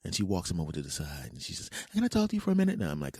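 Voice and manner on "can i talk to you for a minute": put-on much higher, sweet girly voice; sweet woman's voice. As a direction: ask sweetly, up high.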